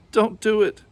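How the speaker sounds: high loud pleading cry. A narrator's reading. Little ghost crying out at the granny, high and loud. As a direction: beg her to stop. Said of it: "I cried".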